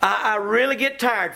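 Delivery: ordinary delivery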